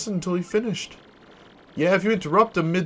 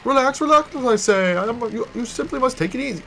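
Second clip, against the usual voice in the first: much higher, forced composure; frantic calm-down; 7 semitones higher, 5 dB louder than usual. Acted. Merchant urging the crew to relax, much higher. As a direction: fake calm over rising panic.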